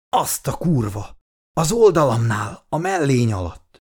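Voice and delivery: strained, groaning answer from a wounded man; wheezing in pain. A narrator's reading. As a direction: force the words out through pain. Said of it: "felelte hörögve".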